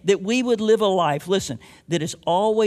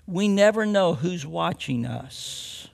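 ordinary talk, nothing marked

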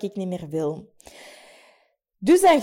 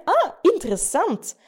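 curious surprise; 8 semitones higher than usual. Shown as a demonstration. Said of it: "en te zeggen".

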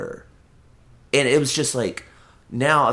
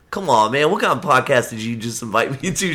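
ordinary talk, nothing marked

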